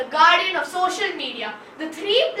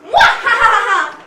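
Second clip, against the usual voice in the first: loud villainous mwahaha; evil cackle; 4 semitones higher, 8 dB louder than usual. Acted. Prophet of doom cackling at the news. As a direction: cackle like a gleeful doom-sayer.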